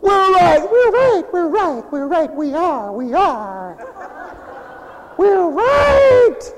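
high, loud mocking chant of we're right; mocking loud insistence. Preacher mocks protesters shouting they're right, high and loud. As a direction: shout it shrill and smug, mocking them. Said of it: "And they talk real loud".

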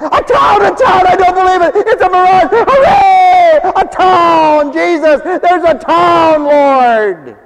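high, loud, overjoyed shouting; excited yelling. Acted. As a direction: shout like you spotted water in the desert. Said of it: "one guy yells out".

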